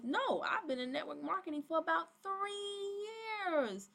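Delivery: high, stretched-out mimicry of a recruit; mock chirpy recruit. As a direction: draw it out high, imitating a proud newcomer. Acts out recruit's chat reply.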